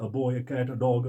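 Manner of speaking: normal talking voice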